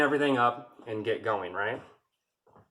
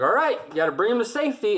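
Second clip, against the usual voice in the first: mock orders; 9 semitones higher, 4 dB louder, faster than usual. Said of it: "drill sergeant was like".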